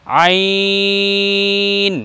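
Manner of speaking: আইন pressed from mid-throat, held long; pressed throat letter. Shown as a demonstration. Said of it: "একটু চেপে বলতে হবে হলকের মধ্যখান থেকে". (হলকের মধ্যখান থেকে চেপে আইন)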